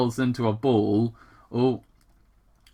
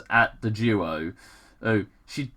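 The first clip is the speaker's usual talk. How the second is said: normal talking voice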